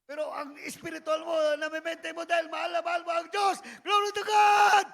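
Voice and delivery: rising from loud to a full shout; building to a shout. Real, not acted. Preacher shouting praise to the congregation, building loud. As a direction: lift them up to praise.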